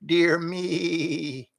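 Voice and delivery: a lightly voiced worried old goat; slow fussy goat sighing to himself; lightly voiced dithering goat; fretful goat. A narrator's reading. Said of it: "heard the goat mutter".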